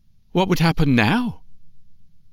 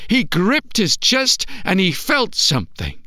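usual speaking voice

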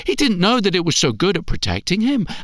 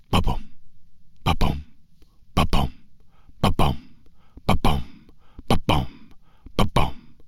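low steady ba-bum like a heartbeat; deep drum-like ba-bum; heartbeat sound; 13 semitones lower, 3 dB quieter than usual. Acted. Heartbeat sound imitated in story.

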